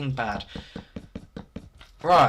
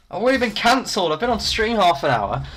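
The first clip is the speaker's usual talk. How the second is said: high, fast, loud protest; mock dismay; 8 semitones higher, 4 dB louder than usual. Streamer cancelled after half an hour, mock dismayed. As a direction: blurt it out in exasperation.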